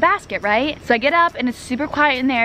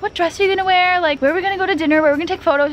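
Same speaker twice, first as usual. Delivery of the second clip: mock excited chatter; 7 semitones higher, much faster than usual. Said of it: "everyone was like".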